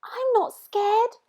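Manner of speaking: high, slow, brave-sounding little monster; Gruffalo's child. Acted. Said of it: "said the Gruffalo's child".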